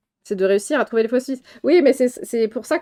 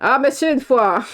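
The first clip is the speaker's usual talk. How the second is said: higher, hammed-up Belgian accent; mock Belgian accent; 4 semitones higher, 3 dB louder than usual. Mocking a film's fake accent to friends, hammed-up Belgian accent. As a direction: put on a caricature Belgian accent. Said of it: "l'accent anglais qui imite l'accent belge".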